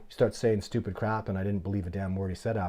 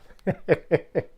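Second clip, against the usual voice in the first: high, loud cackle at the joke; real amused guffaw; burst of laughter; 9 semitones higher, 7 dB louder than usual. Real, not acted. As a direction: laugh out loud at his cluelessness. Laughing at the squirrel answer.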